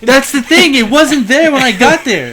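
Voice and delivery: high, loud, drawn-out interjection; loud exclaimed protest. Real, not acted. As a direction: burst in with an indignant objection. Interrupts to protest the missing car.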